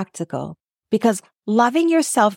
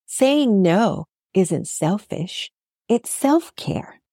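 slow emphasis; slower than usual. Acted. Key point, slowed.

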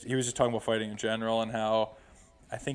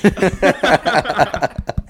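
loud burst of real laughter; big helpless laugh at the punchline; laughing hard; 7 semitones higher, 13 dB louder than usual. Real, not acted. Laughing at his own story.

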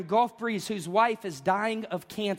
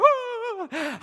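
sudden cry out; 12 semitones higher, 3 dB louder than usual. Retold or imitated. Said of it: "I'm like".

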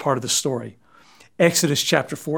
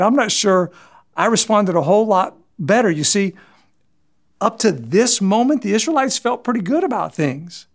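ordinary delivery

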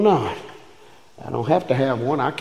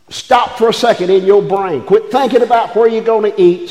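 ordinary talk

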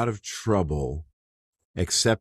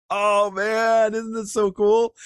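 much higher, delighted outburst; excited marvel; 4 dB louder than usual. Real, not acted. Preacher to his listeners, bursting with excitement. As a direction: gush with real delight.

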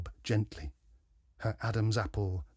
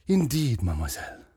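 sighing detective. A narrator's reading. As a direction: sigh it out as the French sleuth. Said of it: "sighed the detective".

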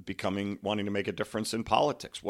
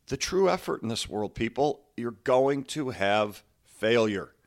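ordinary talk

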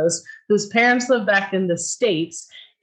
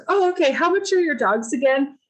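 casual buyer; 7 semitones higher than usual. Retold or imitated. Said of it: "and he goes".

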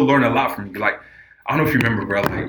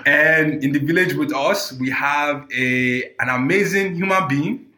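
put-on African accent; 7 semitones higher than usual. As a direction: welcome them like a village chief, African accent. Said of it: "I don't have my African accent together".

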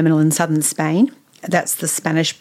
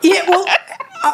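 laughing; 13 semitones higher, slower than usual. Real, not acted. Laughing at the accent joke.

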